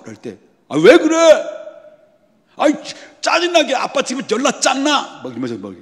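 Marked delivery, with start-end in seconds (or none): 0.7-5.2 high, loud bratty whine; whiny child. Retold or imitated. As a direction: whine like an annoyed kid. Bratty child talks back. (아빠에게 짜증내는 아이, 높고 큰 투정)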